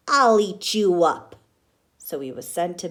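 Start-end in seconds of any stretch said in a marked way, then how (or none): 0.1-1.4 loud, growled threat; defiant shout. Acted. Max shouting at his mother, loud and defiant. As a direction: roar it at mother. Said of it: "and Max said".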